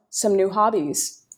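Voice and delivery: normal talking voice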